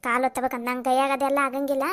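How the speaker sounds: loud, fast brushing-off; annoyed retort. Acted. Daughter-in-law loudly brushing off father-in-law's request. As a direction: shoo him away, irritated.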